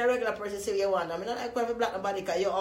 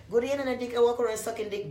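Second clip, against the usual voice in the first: normal talking voice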